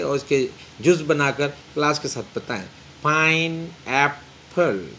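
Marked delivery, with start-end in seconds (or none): 3.0-4.9 pineapple slowly split as pine, ap, ple; syllable by syllable. Shown as a demonstration. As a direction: stretch pineapple into three separate syllables. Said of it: "break the given word in syllable". (Pine apple کو تین حصوں میں توڑ کر آہستہ بولیں (pineapple))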